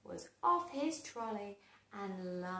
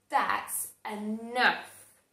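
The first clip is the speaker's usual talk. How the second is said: shouting; 2 semitones lower, 6 dB louder, slower than usual. Acted. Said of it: "shouted Sue Potato".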